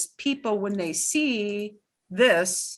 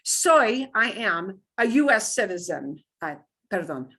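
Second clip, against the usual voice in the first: ordinary talk, nothing marked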